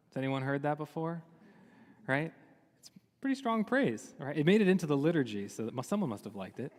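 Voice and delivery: ordinary delivery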